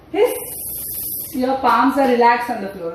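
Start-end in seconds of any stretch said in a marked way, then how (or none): 0.1-0.3 short snake hiss; cobra hiss. Shown as a demonstration. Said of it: "And come up".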